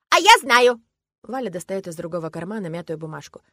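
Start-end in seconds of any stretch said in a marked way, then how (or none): 0.1-0.8 put-on high, very loud childish exclamation; eager little girl. A narrator's reading. Girl knowing where the hospital is, triumphant. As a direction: burst out with the answer.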